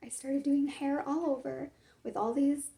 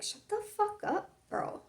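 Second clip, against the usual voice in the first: sharp and much higher, a mock snap; rude snap; 9 semitones higher than usual. Telling off someone bothering her, mock rude snap. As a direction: fire it off like an irritated friend. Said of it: "here you have me who's gonna be like".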